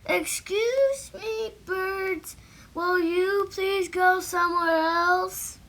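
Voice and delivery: ordinary delivery, nothing marked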